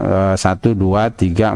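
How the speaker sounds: ordinary delivery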